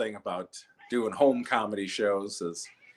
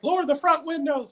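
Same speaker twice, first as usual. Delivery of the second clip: high, mocking cop order; 5 dB louder than usual. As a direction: bark it like a fussy cop. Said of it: "They're like".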